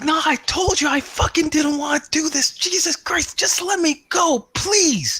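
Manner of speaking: high, desperate begging to be let go; panicked pleading. Acted. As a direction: whine and plead far up in the voice like a cornered man. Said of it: "just kicking and screaming".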